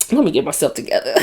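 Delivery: hushed, hurried, getting herself back together after tearing up; quick soft words, still choked up from the funeral scene; composing herself after crying. Real, not acted. Recapping the funeral scene, she hurries softly to compose herself. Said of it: "I'm about to cry now".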